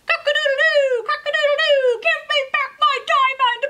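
high, loud rooster crow and shout; crowing rooster demand. Acted. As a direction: crow it out, then demand the button back. Rooster crows again.

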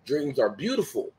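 ordinary talk, nothing marked

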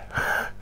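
guttural rasped "kh" without voice; throaty ch sound. Shown as a demonstration. Guide demonstrating place-name sounds, throaty wordless ch. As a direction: scrape air at the back of the throat. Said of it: "sounds in it".

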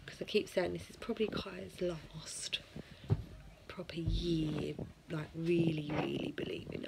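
hushed whisper about Santa. Real, not acted. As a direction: keep it secret, low whisper. Hushed so kids don't hear.

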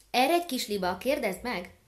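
loud, commanding call from the gander; bossy goose command. Acted. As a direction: send the little goose off with a firm order. Gander sends the little goose.

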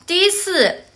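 usual speaking voice

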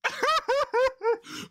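loud high cackle of disbelief; high burst of laughter. Real, not acted. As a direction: crack up high. Reacting to Draco's face.

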